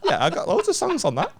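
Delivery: laughing mock chat. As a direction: giggle it out like small talk. Mock-casual chat with mugger.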